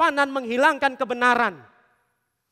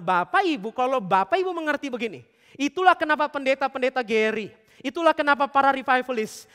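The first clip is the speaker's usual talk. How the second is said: ordinary talk, nothing marked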